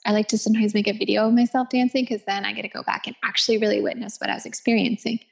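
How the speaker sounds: usual speaking voice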